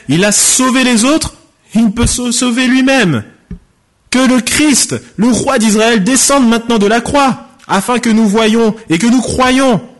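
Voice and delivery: high, ringing derision; mocking. Chief priests scoffing at the crucified man among themselves, mocking. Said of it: "se moquaient entre eux et disaient".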